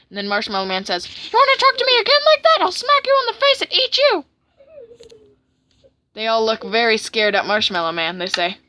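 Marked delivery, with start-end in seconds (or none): 1.1-4.2 very high, loud angry character voice; hammed-up, fed-up tirade; fed-up cartoon villain. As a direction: rant in a strained high cartoon voice.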